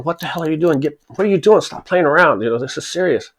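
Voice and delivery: scolding boss. Retold or imitated. Boss scolding his second.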